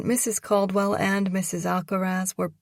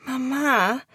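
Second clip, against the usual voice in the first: pleading daughter; 6 semitones higher, 5 dB louder than usual. Acted. Daughter pleading with tearful mother.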